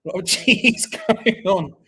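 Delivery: laughing. Real, not acted. Laughing about cheesy match.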